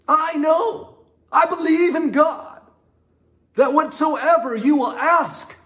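high, loud, fervent plea; Martha pleading. Retold or imitated. Martha telling Jesus God will give what he asks, fervent and high. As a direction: press her faith on Jesus, high and loud. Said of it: "Martha says to him".

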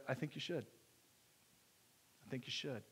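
soft, hushed urging; gentle wife. Retold or imitated. Wife softly urging the pastor to tell the church. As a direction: quietly nudge him to do it.

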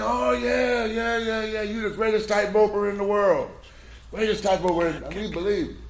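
high, eager crowd gushing agreement. Acted. Crowd cheering the tightroper.

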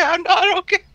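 high, loud squeal; squeaky panic. Acted. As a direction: shriek it in mock panic. Player repeats the squeal.

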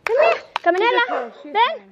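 high, sing-out summons; calling the dog. Real, not acted. Owner to scared dog Canela, calling it over. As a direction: call a pet over in short high bursts.

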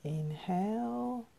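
soft, unhurried breathing cues with long pauses; guided meditation. Real, not acted. Teacher guiding viewers through three gentle breaths, soft meditation voice. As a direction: hush the voice and let each instruction settle.

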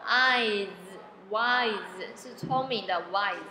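"wise" built slowly from "eyes", ending in a voiced z; sounding out "wise". Shown as a demonstration. Teacher showing learners that SE says Z, sounded out slowly. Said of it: "S E这里不是发S".

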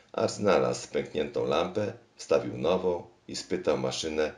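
ordinary delivery, nothing marked

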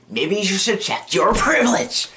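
high, put-on scolding; mock indignant retort. He lectures a friend about privilege, mock indignant. Acted retort in a retold argument.

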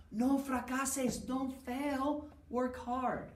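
high, pressing plea to work hard; earnest urging. Acted. Teacher to class, high urgent encouragement. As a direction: push the class on, voice lifted high.

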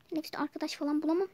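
a fast, nervous rush of words; talking too fast. Acted. Nervous daughter rambling about her first day, fast nervous rush. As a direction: gabble it out in one breath. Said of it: "Kızım çok hızlı konuşuyorsun".

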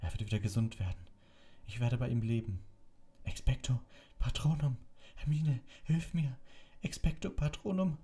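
put-on high, strained boy's voice crying out; desperate Harry. A narrator's reading. Harry begs Hermine for help against Dementors, high and strained. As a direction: push through panic, begging for help.

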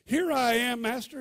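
put-on high, loud call of a boy springing up; eager answer. Boy Samuel answers his master at night, eagerly. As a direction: jump to it, eager to obey.

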